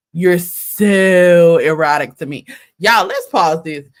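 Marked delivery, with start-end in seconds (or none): 0.1-2.5 slow, loud, breathy-voiced mock seduction; mock sultry Brian. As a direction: ham up a creepy lover's line. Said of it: "Can y'all imagine Brian Garner talking like this?".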